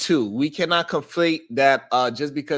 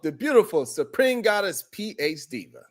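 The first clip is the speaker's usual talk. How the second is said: show host welcome; 9 semitones higher, 4 dB louder than usual. Acted. Host greeting listeners to open the show, big announcer welcome. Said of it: "host opening the show".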